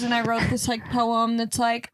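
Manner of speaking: normal talking voice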